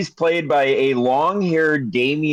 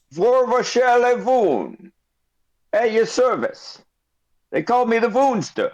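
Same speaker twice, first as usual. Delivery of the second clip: high, slow, grand merchant voice; a pitched-up flamboyant merchant; shopkeeper voice; 9 semitones higher than usual. Acted. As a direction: introduce yourself like a showy shopkeeper.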